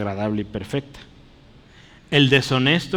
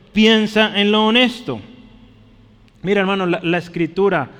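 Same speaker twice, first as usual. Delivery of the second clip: raised, higher preaching voice urging the flock; fervent preaching; 6 semitones higher, 4 dB louder than usual. Acted. Preacher urging the brothers to lift their thoughts, fervent preaching. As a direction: preach it out with conviction.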